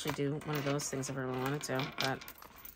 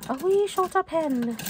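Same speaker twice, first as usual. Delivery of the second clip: much higher, louder and slow in a cartoonish put-on voice; silly put-on voice; 13 semitones higher, 8 dB louder, slower than usual. Vlogger playing with the word wee, cartoonish high voice.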